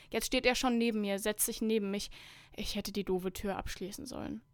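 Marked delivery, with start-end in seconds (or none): none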